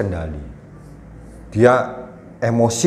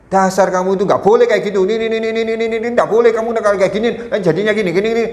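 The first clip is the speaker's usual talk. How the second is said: put-on high, rapid, nagging rant at a child; hammed-up one-way parental scolding, much higher pitch; mock parent scolding; 10 semitones higher, faster than usual. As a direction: rattle off the scolding like a fed-up parent. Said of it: "banyak orang tua salahnya keliru. Hanya satu arah".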